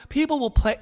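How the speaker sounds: ordinary talk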